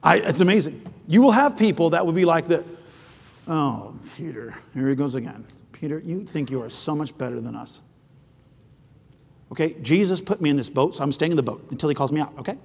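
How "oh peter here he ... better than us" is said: quiet, sneering put-down; scornful mockery. Boat-sitters scorning Peter, quiet sneering mockery. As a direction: belittle him with contempt.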